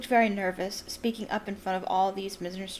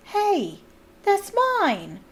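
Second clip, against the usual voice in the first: higher, louder startled cry; surprised; 11 semitones higher, 5 dB louder, much slower than usual. A narrator's reading. Harry recognizes his wand, startled. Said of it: "he said".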